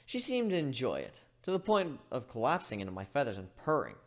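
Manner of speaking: usual speaking voice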